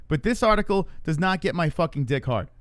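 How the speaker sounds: usual speaking voice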